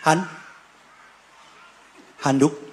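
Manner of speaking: usual speaking voice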